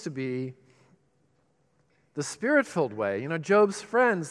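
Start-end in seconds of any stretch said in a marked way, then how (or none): none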